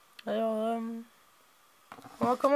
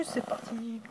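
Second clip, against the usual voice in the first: much softer, teasing the reveal; hushed suspense; 9 dB quieter, much slower than usual. Pretend. Host stalling before the reveal, soft and teasing. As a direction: breathe it out, leaving viewers hanging.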